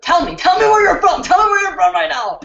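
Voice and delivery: loud, high demand to the chat; calling out. Real, not acted. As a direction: shout it out to the viewers, insisting. Calling out to viewers for locations.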